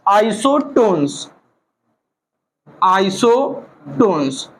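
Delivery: Isotones stretched out slowly, said twice; slow term drill. Shown as a demonstration. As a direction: spell out the new word for the class. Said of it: "तीसरा classification आता है बेटा isotone". (Isotones धीरे-धीरे दोहराना)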